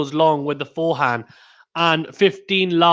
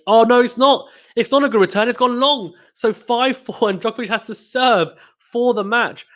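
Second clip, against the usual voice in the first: higher, quickly excited call of the point; rising to the moment as the return goes long; 7 semitones higher than usual. Real, not acted. Commentator reacts to missed return.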